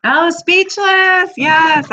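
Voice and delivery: high, loud and drawn-out delight; light up at the show's name; excited. Real, not acted. Reading chat, thrilled to spot her favourite show.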